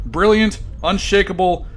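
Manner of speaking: ordinary talk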